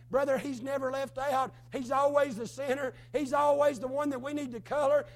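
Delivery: loud preaching, hammering each phrase; fervent preaching. Acted. Preacher urging listeners to trust Jesus, in loud building preaching. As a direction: drive it home to the congregation.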